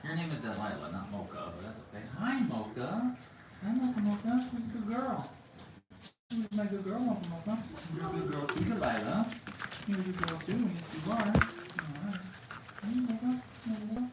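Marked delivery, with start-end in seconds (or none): 2.2-14.0 high sing-song pet talk. Real, not acted. Cooing to the dogs.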